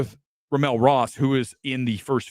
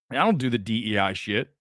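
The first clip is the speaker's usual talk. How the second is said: ordinary talk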